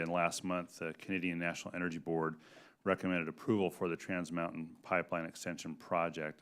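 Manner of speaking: usual speaking voice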